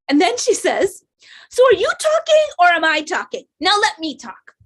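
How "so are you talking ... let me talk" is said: loud, high mock scolding; mom with attitude. Mom silencing her interviewing daughter, loud high mock indignation. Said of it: "little taste of my mom's attitude".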